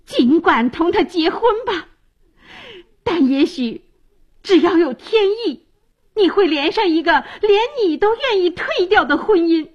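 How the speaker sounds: high-pitched, impassioned calling out; shouting after him. A narrator's reading. Medea calls out at Jason's departing back, high impassioned cry. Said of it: "冲着他的背影大声喊道".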